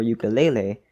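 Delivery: "ukulele" said you-kuh-LAY-lay; you-kuh-lay-lay pronunciation. Shown as a demonstration. Narrator listing ways to say it, "ukulele" as you-kuh-lay-lay. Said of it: "whether you pronounce this instrument as".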